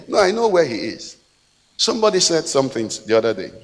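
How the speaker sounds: ordinary delivery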